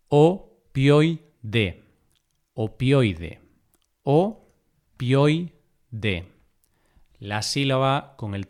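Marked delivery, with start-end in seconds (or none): 0.1-1.8 opioide broken into o, pioi, de; syllable by syllable. Shown as a demonstration. Teacher dividing opioide for learners, syllable by syllable. 4.0-5.5 opioide broken into o, pioi, de; syllable by syllable. Shown as a demonstration. Teacher dividing opioide for learners, syllable by syllable.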